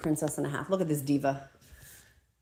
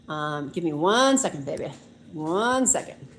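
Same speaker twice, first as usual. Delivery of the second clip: usual speaking voice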